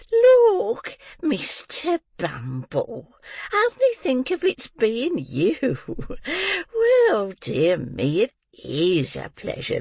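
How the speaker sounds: high, fussing and gushing matron voice; high, drawn-out flattering old woman; fawning landlady. Acted. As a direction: gush over the visitor with false delight. Mrs Mann greets the beadle.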